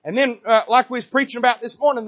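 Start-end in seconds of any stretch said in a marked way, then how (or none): none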